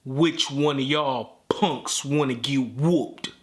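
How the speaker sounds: low, slow, challenging drawl; menacing uncle. Retold or imitated. Uncle challenging the older brothers, low slow menace. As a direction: square up to the teens slowly. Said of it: "these are his exact words".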